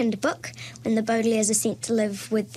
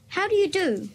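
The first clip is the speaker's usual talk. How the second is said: put-on girl's voice; 7 semitones higher than usual. A narrator's reading. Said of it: "Violet said".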